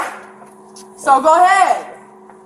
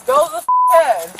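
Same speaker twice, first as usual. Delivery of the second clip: shouting; 10 semitones higher than usual. Real, not acted. Yelling at officer grabbing her.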